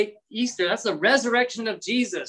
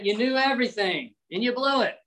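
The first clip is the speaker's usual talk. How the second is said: higher, scornful imitation of the proud; mock arrogance; 5 semitones higher than usual. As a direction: play at looking down on them. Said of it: "that's arrogance, that's pride".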